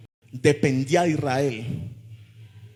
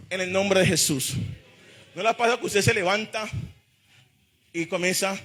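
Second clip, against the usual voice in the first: ordinary delivery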